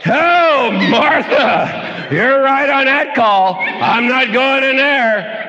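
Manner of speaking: high, slow mock-disgusted Jesus; comic exaggerated Jesus; Jesus hammed up. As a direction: pull back from the stink, slowly.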